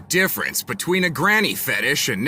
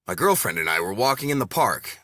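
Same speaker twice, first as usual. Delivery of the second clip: ordinary delivery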